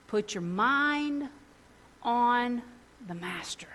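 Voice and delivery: slow words that sink to a whisper; slow, soft scripture line dropping to a whisper; slow fading to whisper. Shown as a demonstration. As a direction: ease down to a hushed whisper on the master. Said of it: "the Message put it this way".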